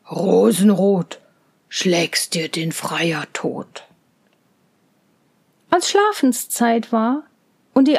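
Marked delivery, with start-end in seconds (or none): 0.1-3.8 low bear voice, slow and half-whispered; a bear joking with the girls; teasing bear. Acted. Bear teases the children.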